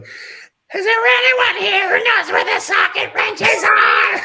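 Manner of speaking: high, loud, slow call up the stairwell; shouted, drawn-out call from the bottom of the stairs; mom calling up the stairs. Retold or imitated. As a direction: holler up to the whole store for help. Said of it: "calling up through the staircase going".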